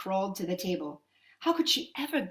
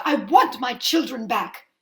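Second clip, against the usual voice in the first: demanding; 8 dB louder than usual. Acted. Mother demands.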